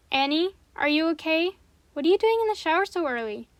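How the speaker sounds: mom calling through a door; muffled voice behind a door. Acted. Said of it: "Her mom's muffled voice".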